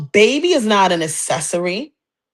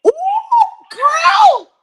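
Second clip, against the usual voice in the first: shocked high cry; 3 dB louder than usual. Real, not acted. Host reacting to the caller's hot take with a squeal. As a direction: squeal it in disbelief.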